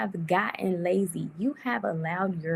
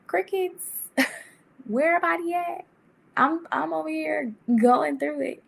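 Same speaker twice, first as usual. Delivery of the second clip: mock indignant call; 10 semitones higher, 5 dB louder than usual. Friends stopped checking in.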